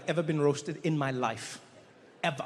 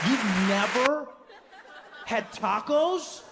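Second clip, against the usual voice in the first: incredulous; 4 semitones higher, much slower than usual. Retold or imitated. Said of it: "Dave's disbelief".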